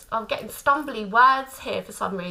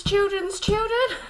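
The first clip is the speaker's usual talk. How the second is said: giggling through the words, pitched up; laughing; 8 semitones higher, slower than usual. Real, not acted. Laughing at herself for repeating the phrase to viewers. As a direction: laugh at her own repetition.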